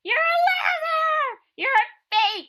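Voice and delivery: high shrill-free jeering shout, slowed; mock heckling crowd. Mocking a crowd of hecklers jeering at the girl. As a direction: jeer at her like a mean crowd.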